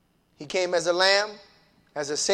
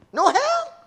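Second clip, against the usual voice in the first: scornful question; 5 dB louder than usual. Acted. Mocking the doubters.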